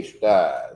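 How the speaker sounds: "Shda" as one squashed syllable, falling in pitch; shortened slang pronunciation. Shown as a demonstration. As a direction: drop the middle of the word and say "Shda". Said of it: "we gonna say Shda".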